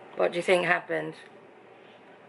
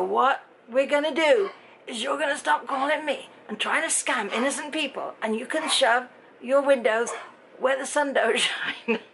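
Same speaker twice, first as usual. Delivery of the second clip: mock scream at scammer; 6 semitones higher than usual. Retelling her rant at a scam caller, hammed-up furious yell. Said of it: "And then I screamed".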